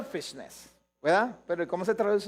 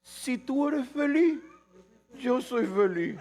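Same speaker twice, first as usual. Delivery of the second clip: higher, slow, syrupy imitation; mock sweet devotion; 5 semitones higher, slower than usual. Preacher mocking what people tell loved ones, slow and syrupy. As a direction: drawl the romantic line with mock tenderness. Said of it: "Uno dice".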